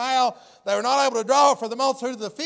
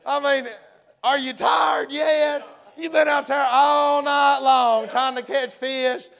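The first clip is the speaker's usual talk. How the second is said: hollering, teasing. Retold or imitated. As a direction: shout out to them, half laughing. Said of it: "He hollers at him".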